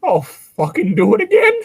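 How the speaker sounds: high, loud cartoonish threat; squeaky silly voice. Silly voice joke, repeated.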